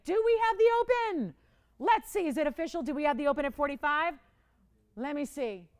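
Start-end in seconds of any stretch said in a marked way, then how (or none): 0.0-2.3 high, loud, eager question; excited high call. Real, not acted. Eager for an opening bid.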